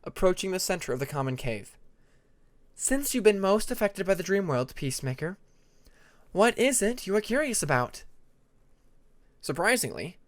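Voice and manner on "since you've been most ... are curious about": lightly voiced, composed female dragon; a slightly higher, measured teacher's voice; inviting a question gently; Tempest, calm dragon mentor. Tempest asks Peacemaker.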